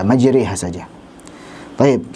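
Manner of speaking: usual speaking voice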